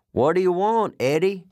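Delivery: irritated high-pitched call; acted yell to someone outside; trucker yelling. Trucker inside the trailer to Eddie outside, yelling. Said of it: "The trucker inside with us yelled".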